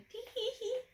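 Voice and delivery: high, airy giggle; happy laugh. A narrator's reading. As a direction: let out a delighted laugh. Mister Quiet laughs out loud.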